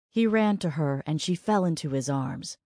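ordinary delivery

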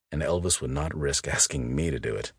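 usual speaking voice

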